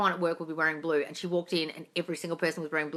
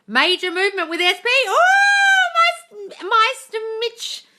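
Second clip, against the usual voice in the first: high and loud, rising into a squeal; excited outburst; 9 dB louder than usual. Real, not acted. Coach reading out a follower's big news, excited outburst. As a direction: read it out with mounting delight.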